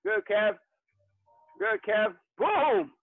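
usual speaking voice

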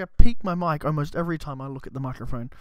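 ordinary talk, nothing marked

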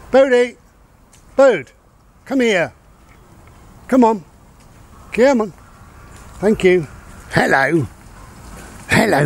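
loud, high calls to the dog across the field; calling the dog. Real, not acted. Owner calling the dog Bodie back on the walk, loud high calls.

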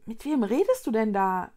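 usual speaking voice

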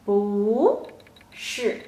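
不 drawn up in a rising tone, then 是 falling; separated demo, 不 rising and 是 falling. Shown as a demonstration. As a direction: lift 不 slowly, pause, drop 是. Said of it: "it changed to a rising".

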